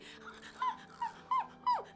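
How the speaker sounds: very high imitated dog squeal; high mock puppy cry; imitated dog yelp. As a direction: yelp like a hurt little dog. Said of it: "started going".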